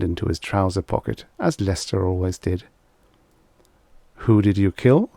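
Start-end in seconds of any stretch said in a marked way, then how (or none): none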